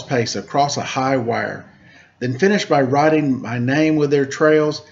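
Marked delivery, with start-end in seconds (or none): none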